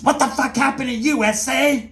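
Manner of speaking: high, sharp demanding question; demanding officer. Retold or imitated. Officer confronting bruised inmate.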